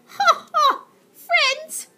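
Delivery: very high, loud mocking cackle; cackling laugh. Aunt Greta scoffing at friendship, shrill cackle. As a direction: cackle like a witch. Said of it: "The lady chuckled to herself".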